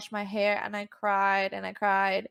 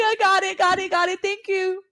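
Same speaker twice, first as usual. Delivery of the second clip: flustered reply; 11 semitones higher, 6 dB louder than usual. Retold or imitated. Retelling brushing off the praise.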